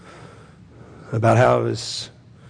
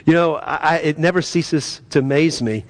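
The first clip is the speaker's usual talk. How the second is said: ordinary talk, nothing marked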